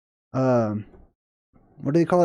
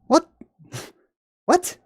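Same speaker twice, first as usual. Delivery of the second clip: incredulous what; 14 semitones higher than usual. Real, not acted. Mock disbelief at quoted excuse.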